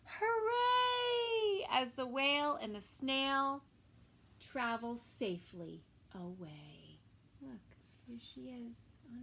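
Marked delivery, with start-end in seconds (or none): 0.1-1.7 high, loud hooray; cheering shout. Acted. Villagers cheering the whale, high shout. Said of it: "villagers shouting". 1.7-7.0 put-on lively storybook reading for kids; reading to children. A narrator's reading. Narrator reading a story to children, lively. Reading picture book aloud.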